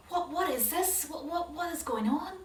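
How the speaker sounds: high, frightened stammering questions; panicked stammer. Acted. Brothers finding the cup, frightened stammer. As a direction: scramble for an answer in fear.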